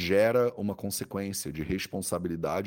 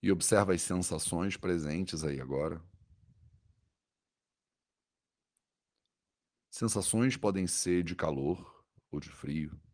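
soothing guided meditation. Acted. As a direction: guide the body scan gently, with long pauses.

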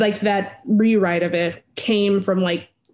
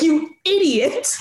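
lightly voiced teasing kids, higher and slower; mocking; 8 semitones higher than usual. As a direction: scoff at him like bratty siblings. Siblings mock Zeke in comic.

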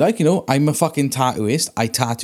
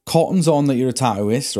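usual speaking voice

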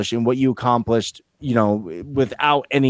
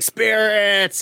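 mock spooky call; 10 semitones higher than usual. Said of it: "he's like".

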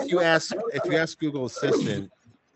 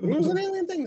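very high, squeaky alien voice; 4 dB louder than usual. Pretend. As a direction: echo it in a tiny high voice. Echoing a joke about aliens.

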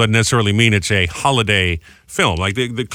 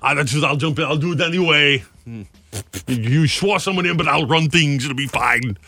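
put-on Austrian strongman voice; slightly raised mock celebrity voice; Arnold Schwarzenegger; 3 semitones higher than usual. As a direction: play a comic Austrian action star offering to take charge. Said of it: "Schwarzenegger would be a pretty cool president".

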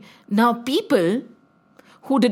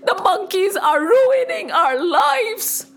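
pleading cry; 11 semitones higher, slower than usual. Acted. Citizens begging King Akbar, very high tearful pleading. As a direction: beg the king in tearful panic. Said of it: "the citizens shouted".

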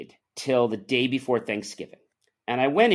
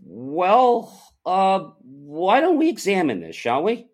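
drawn-out, higher 'let's see' tone; louder, theatrically pondering; mock deliberation; 6 semitones higher, 4 dB louder, slower than usual. Employee answering an accusation about time, mock deliberation.

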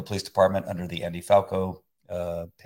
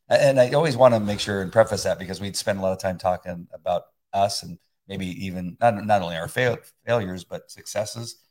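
ordinary talk

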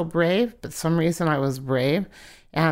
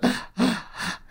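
shocked gasp; 7 semitones higher than usual. Retold or imitated. Shocked by invisible hit.

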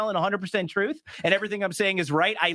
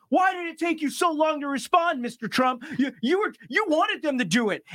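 mocking interviewer voice; 7 semitones higher than usual. Host mimics debate interviewer's question.

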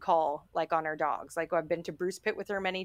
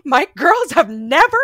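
higher, much louder, frantic apology; mortified owner apologizing to the neighbour, loud; 10 semitones higher, 15 dB louder than usual. Retold or imitated. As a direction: apologize in a rush of embarrassment. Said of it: "poor mortified Nicole".

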